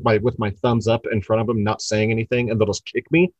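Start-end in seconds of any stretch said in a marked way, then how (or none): none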